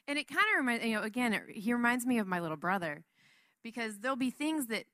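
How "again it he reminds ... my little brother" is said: ordinary delivery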